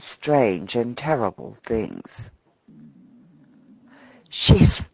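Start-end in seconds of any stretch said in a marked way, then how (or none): 4.3-4.8 high, loud, slow panted warning; panting. A narrator's reading. Freckles panting a warning to the trapped lobbyist. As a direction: pant it out in mock fright. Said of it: "panted Freckles".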